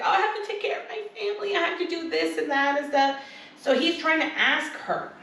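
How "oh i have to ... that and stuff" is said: mock crying. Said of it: "She's like".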